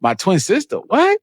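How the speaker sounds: squeaky high outburst of confusion; replaying a scene that makes no sense; shocked disbelief. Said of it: "I was like".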